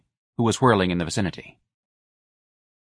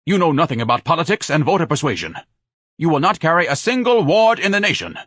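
loud pompous rant; 9 semitones higher, 5 dB louder than usual. A narrator's reading. Ignatius ranting.

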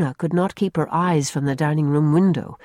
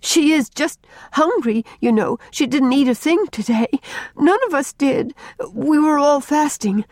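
put-on high, urgent child explaining; worked-up little girl, pitched way up; hysterical relief; 8 semitones higher than usual. A narrator's reading. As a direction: blurt it out, overwrought. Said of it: "sobbing hysterically in her relief".

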